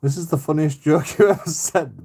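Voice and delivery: high and loud, laughing; cracking up mid-sentence. Real, not acted. Cracking up at a joke.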